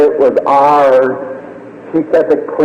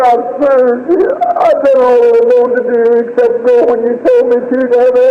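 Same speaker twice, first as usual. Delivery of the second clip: high, sustained grieving plea; anguished prayer; 6 semitones higher than usual. Retold or imitated. Father praying for his dying child, anguished pleading. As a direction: beg God with a breaking heart.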